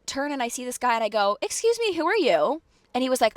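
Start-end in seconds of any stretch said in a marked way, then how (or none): 1.4-2.6 high, loud retold challenge; confrontational. Tenant confronting a strange guy in the garage, acted affronted challenge. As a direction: challenge a stranger, pitched right up.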